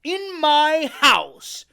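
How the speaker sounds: very loud, high, slow outrage; outraged cry. Acted. Silver outraged in his own tavern, loud and slow. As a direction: roar it in disbelief. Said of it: "cried Silver".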